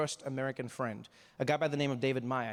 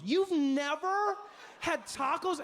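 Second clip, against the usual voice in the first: high incredulous; 11 semitones higher, much slower than usual. Retold or imitated. Dave even more incredulous.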